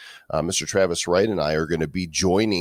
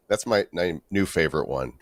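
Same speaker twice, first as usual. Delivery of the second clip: ordinary talk, nothing marked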